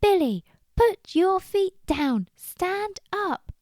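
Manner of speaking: high, slow, emphatic shout; shouted command. Acted. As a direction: shout each word to get through the noise. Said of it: "she shouted".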